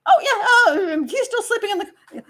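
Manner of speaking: high, laughing, stumbling cover story; flustered mock lie. Parent caught off guard by relatives, laughing nervous lie. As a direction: fumble the excuse with a nervous laugh. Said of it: "Where's Dior?".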